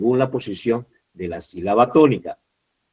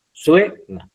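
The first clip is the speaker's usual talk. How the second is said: "suena" said higher and a bit louder, leaning on "sue"; showing syllable stress; 6 semitones higher, 4 dB louder than usual. Shown as a demonstration. Teacher shows the class where the stress falls. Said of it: "en este caso".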